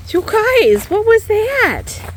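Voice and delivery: high, drawn-out startled call to the chickens; wide-eyed amazement, slowed down. Real, not acted.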